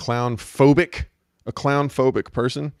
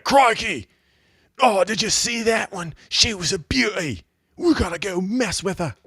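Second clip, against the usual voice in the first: mock harsh Aussie accent, crying out over a find; Crocodile Hunter; 9 semitones higher than usual. Host mocking a wildlife man spotting a beast, excited Australian accent. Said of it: "Makes me think of Crocodile Hunter".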